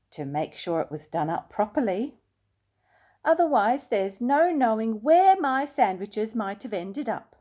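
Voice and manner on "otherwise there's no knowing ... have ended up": louder, higher and emphatic; emphatic bear. A narrator's reading. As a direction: press the point earnestly. Paddington stresses his point.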